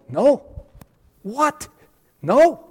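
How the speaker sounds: high, loud flat refusal; emphatic students. Retold or imitated. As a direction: shout it down firmly. Class emphatically refusing him.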